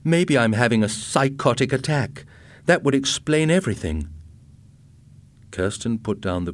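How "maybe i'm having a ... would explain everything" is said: anxious, raised-pitch worry; shaking voice. A narrator's reading. Husband fears he is having a psychotic attack, shaken. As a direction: let worry lift the voice. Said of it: "His voice was shaking".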